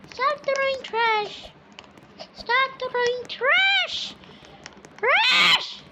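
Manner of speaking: high, slow yelling that ends in a shriek; screaming at brother. Real, not acted. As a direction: scold your brother with a high, drawn-out yell. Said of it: "I shouldn't scream".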